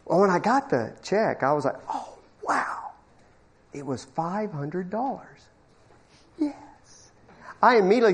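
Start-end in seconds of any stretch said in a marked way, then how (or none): 1.9-2.9 hushed, airy, unvoiced wow; breathless wow. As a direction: gasp it out under your breath. Underwhelmed by the check.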